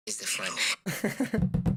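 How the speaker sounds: slow, stretched whisper; slow playful whisper; drawn-out whisper. Acted. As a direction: whisper it out long and teasing. Teasing end of repeated phrase.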